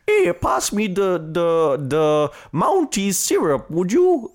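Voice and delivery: slow, high, hammed-up Canadian drawl; a jokey over-the-top Canadian announcer; mock Canadian. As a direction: lay on a cartoon Canadian voice. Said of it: "he's not, like, stereotypically Canadian".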